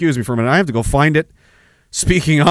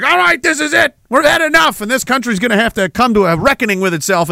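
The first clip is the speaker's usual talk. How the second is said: higher, hammed-up rallying cry; mock conservatives; 7 semitones higher than usual. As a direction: ham up a fake defiant stand. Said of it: "you conservatives were gonna be like".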